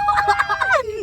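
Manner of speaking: high, loud burst of laughter; shrieking laugh. Real, not acted. Loud laugh at kissing joke. (malakas at matinis na tawa)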